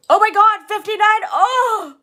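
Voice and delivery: loud high surprised shriek; excited shriek. Real, not acted. Streamer seeing fifty-nine viewers, loud surprised shriek. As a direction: shriek at the number.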